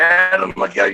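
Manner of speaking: mock warning. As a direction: call out as if danger is coming.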